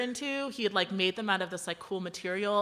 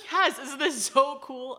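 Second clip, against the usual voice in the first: excited; 4 semitones higher, 4 dB louder, slower than usual. Retold or imitated. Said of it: "he's so excited".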